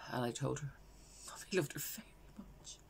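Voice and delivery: grief-stricken, hushed, long breathy pauses; Valerie. Acted. Bereaved mother recalling saying goodbye to her daughter, hushed grief. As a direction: let it sink softly to a near whisper. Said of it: "this is a character called Valerie".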